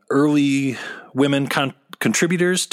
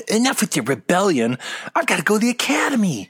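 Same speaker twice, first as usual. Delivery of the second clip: whiny Luke voice; 8 semitones higher, faster than usual. Pretend. Mock Luke quote.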